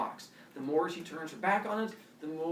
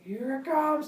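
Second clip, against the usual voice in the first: sing-song feeding; 11 semitones higher, 4 dB louder, slower than usual. Acted. [name] feeding the baby.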